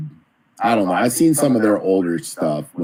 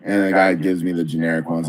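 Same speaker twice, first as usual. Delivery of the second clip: ordinary talk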